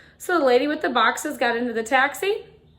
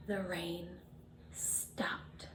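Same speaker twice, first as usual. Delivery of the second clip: gasp and whisper; 5 semitones lower, 19 dB quieter, much slower than usual. Shown as a demonstration. Climax reveal to children.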